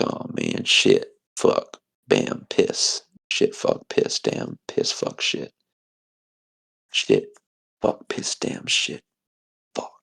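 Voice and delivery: string of curses hissed under the breath; whispered cursing. Acted. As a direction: mutter the swears in a fading whisper. Wife curses after husband leaves.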